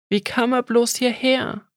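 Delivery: ordinary delivery, nothing marked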